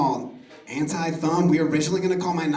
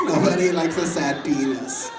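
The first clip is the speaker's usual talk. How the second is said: ordinary talk, nothing marked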